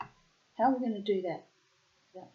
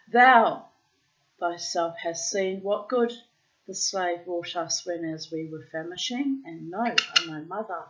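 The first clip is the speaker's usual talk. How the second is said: put-on loud, deliberate read-aloud of an old tale; storybook reading; 8 dB louder than usual. A narrator's reading. Reading an old tale aloud to the bird, deliberate storyteller voice. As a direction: read it out like an Arabian Nights storyteller.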